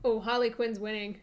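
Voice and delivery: ordinary talk, nothing marked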